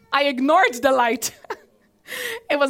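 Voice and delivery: chuckling through the words, laughing at her own folly; laughing at herself. Real, not acted. Pastor laughing at her own mistake to the congregation, laughing. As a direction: let real laughter break into the confession.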